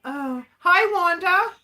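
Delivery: excited greeting. Real, not acted.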